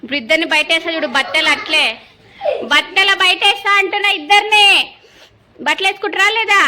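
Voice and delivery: fast, high, loud scolding; angry threat. Real, not acted. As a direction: threaten to throw them out. Mother threatening kids.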